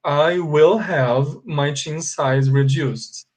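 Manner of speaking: slow, every word of "I will have my chin size reduced" separate; deliberate teacher dictation; slow and marked. Shown as a demonstration. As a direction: spell it out slowly for the class. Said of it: "eu tenho que falar assim".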